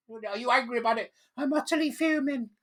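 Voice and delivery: high put-on camp voice; mock fuming. The vlogger voicing a smug activist, high and camp. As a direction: lift it up high and preen.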